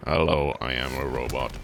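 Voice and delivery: low, flat robotic monotone. Retold or imitated. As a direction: speak like a vocoder robot. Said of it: "That's a pretty good robot voice".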